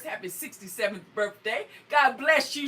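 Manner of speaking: ordinary delivery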